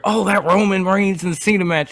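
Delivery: high-pitched mocking fan voice; complaining fans. Quoting hypocritical fans on the big match, mocking complaint. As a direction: mimic the grumblers up high. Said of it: "those same sorts of people were out here".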